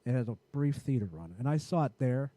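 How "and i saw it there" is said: ordinary talk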